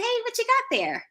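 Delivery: put-on high-pitched question to a little kid; acted high, inquisitive greeting; friendly curiosity. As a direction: ask a child, voice lifted way up. Acted greeting to a young boy.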